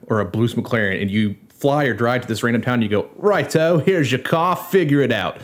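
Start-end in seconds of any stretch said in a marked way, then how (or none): 3.2-5.4 quick Cockney quip, a bit higher; a light London Cockney voice; Cockney accent. Retold or imitated. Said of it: "Forgive my Cockney accident".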